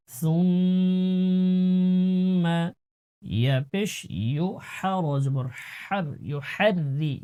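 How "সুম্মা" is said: সুম্মা with a soft lisped ث, long held ghunna, very slow; soft tha sound. Shown as a demonstration. Teacher models the soft tha for the class, chanted. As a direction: breathe the সা gently in সুম্মা. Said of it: "সার উচ্চারণ নরম করে".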